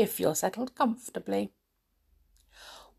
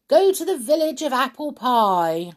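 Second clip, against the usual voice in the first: high, raised command; loud cry; 7 semitones higher, 5 dB louder than usual. A narrator's reading. Pixie commands the magic chair, crying out loudly. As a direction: call it out like an order. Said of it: "the pixie cried out loudly".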